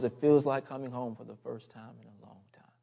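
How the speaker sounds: soft, fading near-whisper; hushed lyric. Retold or imitated. Preacher quoting a worship song to the congregation, hushed. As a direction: recite it tenderly, trailing into a whisper.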